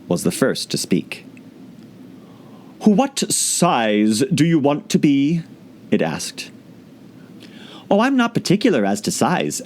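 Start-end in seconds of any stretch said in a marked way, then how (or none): 2.8-5.4 higher, very slow creature voice; slow caterpillar voice. A narrator's reading. Caterpillar asking Alice, very slow. 7.9-9.6 hasty. A narrator's reading. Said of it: "Alice hastily replied".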